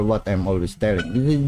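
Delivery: normal talking voice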